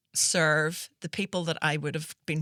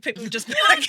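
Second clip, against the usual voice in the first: usual speaking voice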